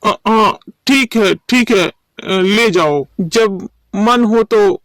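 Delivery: slow, halting, nervous giving-in; scared stammer. Acted. Shopkeeper letting Gopal take goods on credit, frightened. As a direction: stumble fearfully over the words, backing down.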